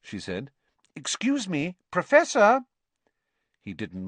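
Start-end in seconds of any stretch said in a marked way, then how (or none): 0.9-1.8 high-pitched polite call; politely seek his attention; tentative and light; cautious. A narrator's reading. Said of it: "approached Professor Lupin cautiously". 1.9-2.6 loud, very high call; insistent high summons. A narrator's reading. Trying to rouse a sleeping teacher.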